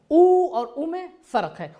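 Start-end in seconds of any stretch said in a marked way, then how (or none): 0.1-0.5 او held long and higher, "ooo"; long raised "oo" on او; stretched long vowel. Shown as a demonstration. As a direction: draw او out as a long "oo". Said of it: "او کھینچ کر پڑھتے ہیں".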